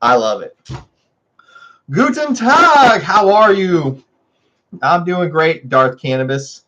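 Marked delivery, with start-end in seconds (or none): 1.9-4.0 high, slow mock German hello; hammed-up German greeting. Greeting a viewer in German, hammed-up and high. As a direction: stretch out a cheesy German greeting.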